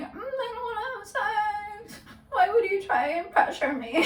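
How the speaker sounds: high, hammed-up tearful whine; put-on weepy pleading that tips into laughter; manipulative fake crying. Woman pretending to cry at a pushy date, mock whimper. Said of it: "So I start crying".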